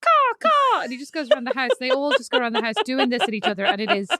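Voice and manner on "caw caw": high, much higher cawing like a crow; mock crow calls, pitched way up; crow caw imitation. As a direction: caw like a kid playing crow. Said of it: "And just going".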